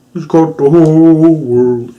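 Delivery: slow, loud, drawn-out sung impression; mock man's voice. Imitating the possessed kid singing in a man's voice. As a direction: belt it slowly in a possessed-kid voice. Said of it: "like, a, a man's voice".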